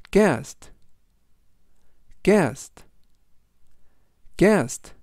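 "guest" said crisply with a falling end; clear English demo. Shown as a demonstration. Teacher models "guest" three times for listeners. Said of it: "라고 발음하시면 되겠고요".

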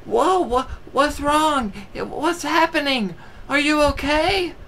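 very high, squeaky voice of a worried friend; mock worried friends. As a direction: squeal the concern like a cartoon. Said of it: "all of his friends go".

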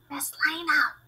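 calling out. A narrator's reading. Calling into the shed.

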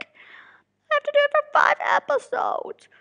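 high-pitched, whimpering complaint; mock whining. Real, not acted. Narrator facing five episodes, whining high. Whines about narration workload.

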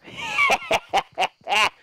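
high, squealing laugh; gleeful cackle. Real, not acted. Gamer after hitting the minigun guy, gleeful cackle. Gloats after landing a hit.

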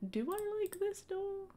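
high, slow quizzical voice; doubtful question. Real, not acted. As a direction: ask yourself in a squeaky, slow voice. Questioning own choice.

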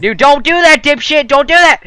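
high, loud frantic yelling; hyped gamer bro. Real, not acted. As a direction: scream at your buddy to stop. Friend breaks furnace by hand.

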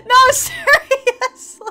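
laughing through the words; real laughter breaking in. Real, not acted. Laughing at a gift.

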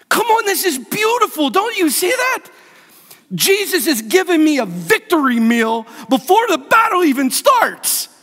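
high-pitched, fervent appeal; impassioned preaching. Real, not acted. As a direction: urge the congregation to see it. Preaches excitedly about victory meal.

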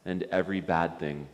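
prayer. Acted. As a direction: lead a gentle prayer phrase by phrase. Leads prayer for repetition.

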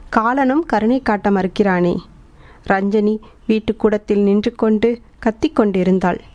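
ordinary delivery

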